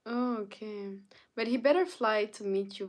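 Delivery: ordinary talk, nothing marked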